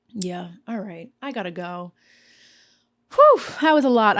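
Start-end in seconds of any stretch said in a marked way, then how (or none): 3.1-4.2 loud breathless exhale after a long rant; real breathlessness, louder; out of breath. Real, not acted. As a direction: puff it out, winded. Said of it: "I'm out of breath".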